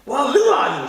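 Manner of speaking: loud, sharp cross question; angry demand. Acted. Grumpy boy demanding who the superpower is, angry demand.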